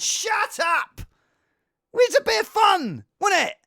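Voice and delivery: high, loud mock-indignant yell; exasperated shouting. Streamer defending a bit of fun, indignant yelling. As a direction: yell back in comic exasperation. Acted retort to critic.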